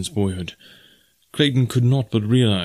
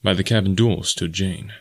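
plain reading voice

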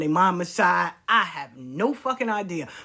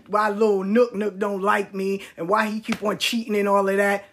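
normal talking voice

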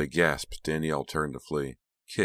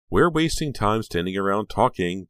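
politely impatient; 5 semitones higher, 7 dB louder than usual. Acted. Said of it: "Dee said impatiently".

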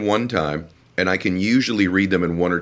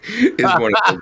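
laughing; 5 dB louder than usual. Real, not acted. Joking intro, laughing.

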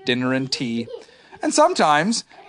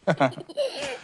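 pleased little chuckle; 7 semitones higher, 6 dB quieter than usual. Acted. Pooh laughs at his poem.

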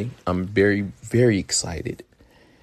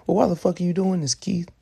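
higher, fast, lightly voiced skeptical listener; imagined listener; 9 semitones higher than usual. Listener questioning the host's plan, mild mock skeptical voice. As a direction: toss the listener's doubt back at yourself. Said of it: "And I know you're thinking".

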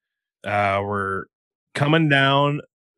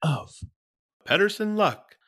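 segment announcement; 9 semitones higher than usual. Acted. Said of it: "It is time for another segment".

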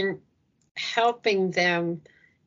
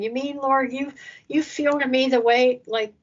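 tender wonder; 5 semitones higher than usual. Retold or imitated. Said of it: "you just ponder on that".

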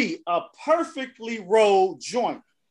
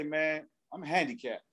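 soft, lower, hangdog excuse; mock solemn; 7 semitones lower, 9 dB quieter than usual. Imitating the friend leaning back in his wheelchair, quietly. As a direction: say it quietly with a sad look. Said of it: "he got that look".

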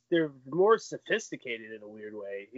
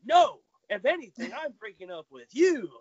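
Seinfeld comeback; 6 semitones higher, 6 dB louder than usual. Retold or imitated. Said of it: "like that Seinfeld episode".

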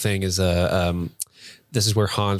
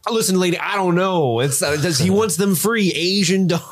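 high-pitched, mock exasperation; hammed-up, pitched-up impatience; exasperated phone caller; 8 semitones higher, slower than usual. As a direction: imitate an agitated man spelling it out on a call. Acting out phone quote from film.

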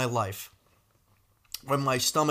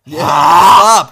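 pleading shout; 2 semitones higher, 16 dB louder than usual. Real, not acted. Begging friend to stop dog talk.

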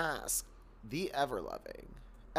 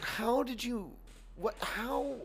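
incredulous; 9 semitones higher, slower than usual. Retold or imitated. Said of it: "And I was like".

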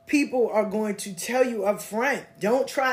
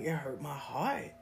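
imitating tearful mother; 6 semitones lower, 10 dB quieter than usual. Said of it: "she was crying".

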